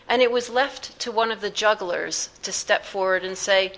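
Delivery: ordinary delivery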